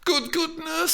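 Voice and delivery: put-on high girlish nagging; pestering. A narrator's reading. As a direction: nag her like an impatient girl. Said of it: "pestering her".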